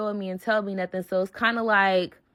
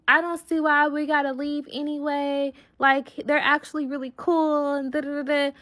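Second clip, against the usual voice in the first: higher-pitched mock friend voice; imitated friend protesting; 6 semitones higher than usual. Friend arguing they should stay, mock protesting. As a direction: put on her high, reluctant objection.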